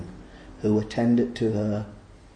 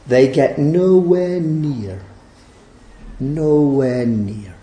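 nowhere drawn out slowly and hit hard; stress on nowhere; 6 dB louder, much slower than usual. Acted. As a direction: lean slowly on nowhere near, twice. Preacher stressing the repeated phrase.

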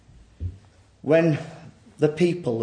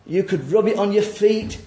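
ordinary talk, nothing marked

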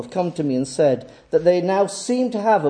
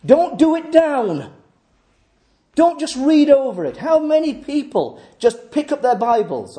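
higher, emphatic pulpit appeal; exhorting preacher; 7 semitones higher than usual. Acted. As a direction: urge the congregation hard. Exhorts the congregation.

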